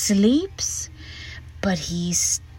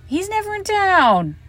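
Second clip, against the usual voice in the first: much higher, exasperated; 11 semitones higher than usual. Real, not acted. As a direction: pitch it way up in disbelief. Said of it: "repeated with emphasis".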